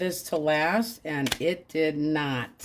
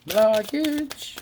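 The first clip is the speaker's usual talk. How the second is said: "Blockage" repeated higher and louder; emphatic repeat; 6 semitones higher, 4 dB louder than usual. Shown as a demonstration. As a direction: punch "Blockage" up high the second time.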